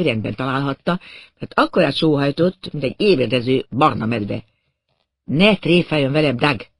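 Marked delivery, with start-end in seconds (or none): none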